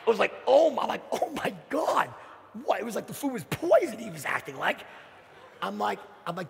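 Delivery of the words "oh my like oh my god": loud, high "Oh my God"; horrified gasp. Retold or imitated. The woman at the door, recoiling from the meat offer, loud and shocked. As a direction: recoil as if the food were poison.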